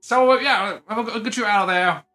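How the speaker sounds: ordinary talk, nothing marked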